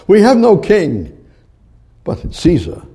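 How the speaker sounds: loud high cry of the mob; crowd shouting. Retold or imitated. The crowd rejects Jesus before Pilate, loud shout. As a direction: fling it out like an angry crowd. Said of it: "The crowd hated him".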